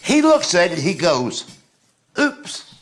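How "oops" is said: mock oops. Retold diner reacts to his food.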